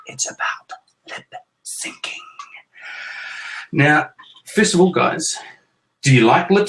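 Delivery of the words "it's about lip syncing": whisper. Acted. Teasing reveal of topic.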